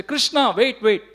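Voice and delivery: high-pitched call after someone leaving; calling out loudly. Retold or imitated. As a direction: call out as an anxious mother would. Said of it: "Mother Yashoda would call out loudly".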